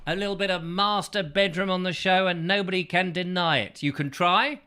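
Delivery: usual speaking voice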